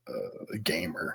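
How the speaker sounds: whispering. Real, not acted. Whispered joke about absent friend.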